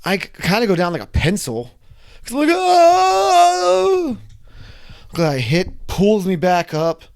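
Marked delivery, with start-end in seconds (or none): 2.5-4.2 one high, loud crying shout; reenacted cry of terror; terrified scream. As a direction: let out a falling scream. Dropping like a pencil.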